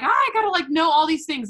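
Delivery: high, loud mock panic; mock overwhelmed panic. Mocking overwhelmed viewer.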